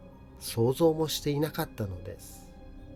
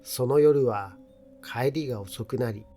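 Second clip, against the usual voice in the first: plain reading voice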